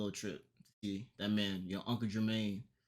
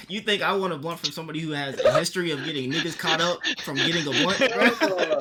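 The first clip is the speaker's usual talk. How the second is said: incredulous; 5 semitones higher, 9 dB louder than usual. Real, not acted. Incredulous refusal of a blunt.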